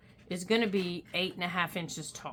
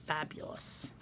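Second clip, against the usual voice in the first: breathy whisper; 4 semitones lower, 7 dB quieter than usual. Real, not acted. Gushing over the vellum paper.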